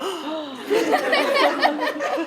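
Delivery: rising amazed gasp; gasp of awe. Shown as a demonstration. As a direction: gasp in wonder. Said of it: "They're all going".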